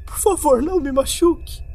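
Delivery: high, trembling plea through tears; frightened crying double begging; tearful pleading. Acted. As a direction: beg him not to hurt you, near sobbing. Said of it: "notei lágrimas em seus olhos".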